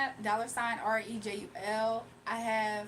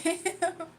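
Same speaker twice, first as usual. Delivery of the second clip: kind gentle laugh; 6 semitones higher, 4 dB louder than usual. Real, not acted. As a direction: giggle helplessly, kindly. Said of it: "I don't know why I'm giggling".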